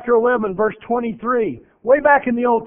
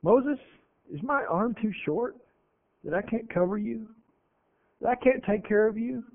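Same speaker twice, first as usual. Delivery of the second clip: soft, gentle voice of God; God speaking softly; 11 dB quieter than usual. Retold or imitated. God quietly reassuring a frightened Moses, soft and steady. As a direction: hush it down, calm and sure, like God reassuring.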